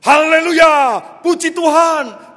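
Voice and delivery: high, loud shouted haleluya. Acted. Pastor spurring the horse to gallop, full shout. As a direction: yell it to spur the horse on. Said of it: "ia berteriak".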